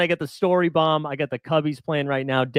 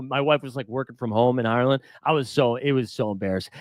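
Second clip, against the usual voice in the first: ordinary talk, nothing marked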